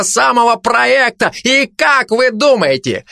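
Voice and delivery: scornful start climbing to a high, heated climax; building tirade. Acted. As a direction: work up into an indignant cry. (обличительно, с нарастающим жаром)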